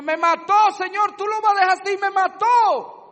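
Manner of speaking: Abel's blood pleading with God, a desperate wail; crying blood of Abel. Acted. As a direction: cry out for justice like murdered blood. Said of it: "Esa sangre yo la oigo diciéndome".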